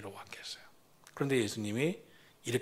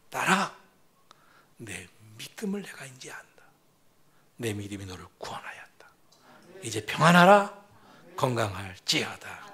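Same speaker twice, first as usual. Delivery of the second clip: tender whisper; 5 semitones lower than usual. Acted. Jesus speaking tenderly to woman.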